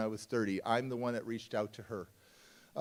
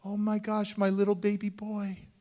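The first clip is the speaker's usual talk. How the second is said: mother gushing in a higher voice; 7 semitones higher than usual. Retold or imitated. Birth mother answers his call, gushing in a higher voice. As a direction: coo it like a mom hearing her son. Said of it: "She goes".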